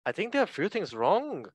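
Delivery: put-on high, swooping botched Scouse accent; Jamie Carragher. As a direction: put on Scouse and lose control of it. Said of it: "let me try this again".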